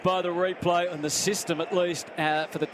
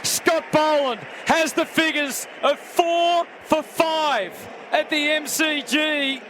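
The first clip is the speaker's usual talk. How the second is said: loud, high, thrilled shout; excited wicket call; 9 semitones higher, 4 dB louder than usual. Real, not acted. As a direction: roar the wicket over the crowd. Root dismissed, live call.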